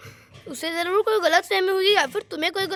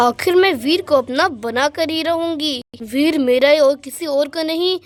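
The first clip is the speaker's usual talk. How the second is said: lower, plotting voice of the jealous rival; scheming rival; 3 semitones lower than usual. Acted. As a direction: vow to steal him, lower and determined. Alexa's scheming villain monologue. (वीर को छीनने की कसम खाती जलनखोर Alexa, धीमी गहरी आवाज़ में)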